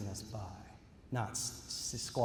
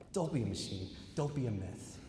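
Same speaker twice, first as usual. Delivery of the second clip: ordinary delivery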